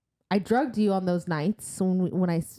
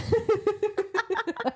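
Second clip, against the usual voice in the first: squealing laugh; a high burst of real laughter; high giggle. Real, not acted. Laughing at the story.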